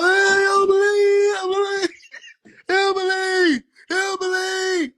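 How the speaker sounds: very high, loud drawn-out wail; hammed-up crying, stretching each word; mock wail. Acted wailing in a retold exchange.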